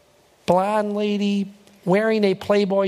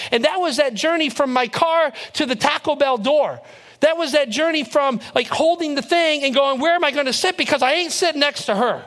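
higher-pitched, driving sermon delivery; impassioned preaching; 5 semitones higher than usual. Real, not acted. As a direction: build with insistence, stressing each phrase. Preaches the point with rising force.

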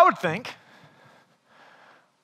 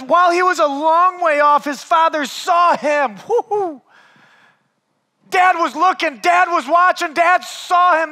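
fervent preaching; 3 semitones higher than usual. Acted. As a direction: preach it, climbing in force. Preacher building on the father.

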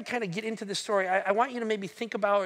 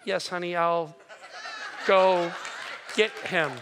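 meek yes honey; 2 semitones lower, slower than usual. Retold or imitated. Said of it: "And of course I say".

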